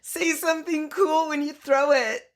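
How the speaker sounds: high-pitched retold urging; Jonah Hill. Urging his partner as he throws, high mock instruction. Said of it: "Jonah Hill is like".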